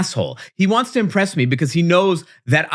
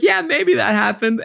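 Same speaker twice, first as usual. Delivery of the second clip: coy; 8 semitones higher than usual. Retold or imitated. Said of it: "And I was like".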